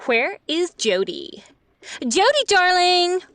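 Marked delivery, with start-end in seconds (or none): 2.0-3.2 higher, louder call down the hall. Acted. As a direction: call for the daughter across the house. Mother calling through the house.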